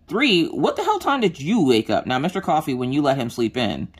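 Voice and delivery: usual speaking voice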